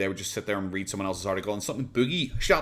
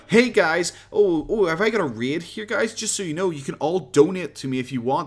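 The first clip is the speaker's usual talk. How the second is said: higher, louder put-on perky pitch; mock eager streamer; 6 semitones higher, 5 dB louder than usual. Commentator mimicking a YouTuber working viewers for donations, mock eager. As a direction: imitate a streamer greeting a raid and begging. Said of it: "where he's just like".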